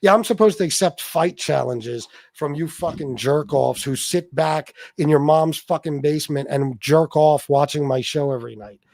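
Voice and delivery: ordinary delivery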